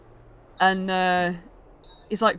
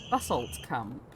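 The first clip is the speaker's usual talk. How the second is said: usual speaking voice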